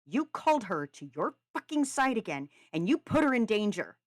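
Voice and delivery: furious character outburst; raging. A narrator's reading. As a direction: rail at him, hitting the accusations hard. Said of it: "Callum raged".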